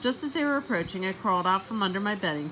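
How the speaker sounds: ordinary delivery, nothing marked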